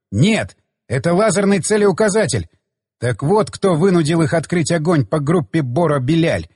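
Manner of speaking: ordinary delivery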